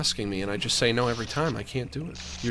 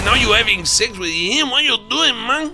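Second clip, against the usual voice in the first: put-on high, loud Chicano-accented wailing rant; Cheech Marin impression; 12 semitones higher, 8 dB louder than usual. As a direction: play Cheech Marin in a hammed-up Chicano accent, pleading with the cheating wife. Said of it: "my Cheech Marin impression".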